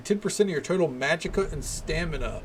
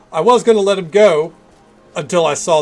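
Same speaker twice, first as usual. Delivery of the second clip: excited; 4 semitones higher, 11 dB louder than usual. Real, not acted. Excited justification after killing.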